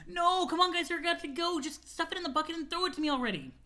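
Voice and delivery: high-pitched urgent protest; urgent pleading. Acted. Friend begging guys to go, high urgent plea. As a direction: plead high, hurrying them along. Character pleads as they must go.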